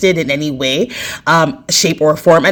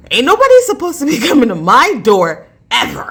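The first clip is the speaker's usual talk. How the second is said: high, louder incredulous rant with a laugh; mock outrage at an unwanted knock; laughing disbelief, pitched up; exasperated outburst; 5 semitones higher, 4 dB louder than usual. Retold inner outburst at knock.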